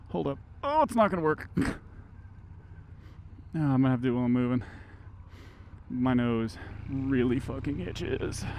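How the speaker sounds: ordinary talk, nothing marked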